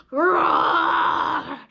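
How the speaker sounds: loud, drawn-out playful roar; lion roar. Pretend. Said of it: "lots and lots of roaring".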